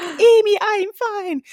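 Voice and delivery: very high, loud mock-mom voice; imitating mom again. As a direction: squeak it out like mom insisting she's fine. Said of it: "I can't do my mom's accent".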